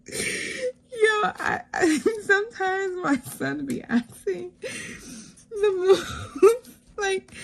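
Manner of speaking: high, cracked-up voice; helpless giggly speech; laughing while talking. Real, not acted. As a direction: squeeze the words out through real laughter. Cracking up at son's question.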